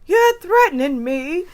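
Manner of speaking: mock outraged movie quote; Trading Places line. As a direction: deliver it like the film line. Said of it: "in Trading Places".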